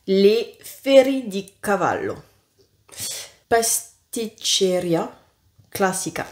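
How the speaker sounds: careful, slow Italian reading of the product names; sounding out the Italian names, unsure of the accent; Italian accent attempt. Shown as a demonstration. Reading Italian biscuit names to viewers, in a tentative Italian accent. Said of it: "J'espère que mon accent n'est pas trop pourri".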